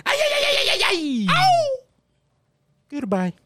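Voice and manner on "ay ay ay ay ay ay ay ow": high, ringing ay-ay-ay cry; sign-off yell. Acted. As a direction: belt out a joyful high ay ay ay.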